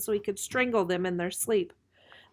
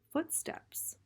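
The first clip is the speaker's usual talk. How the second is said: whisper; 10 semitones higher, 6 dB quieter than usual. A narrator's reading. Hushed listening in dark.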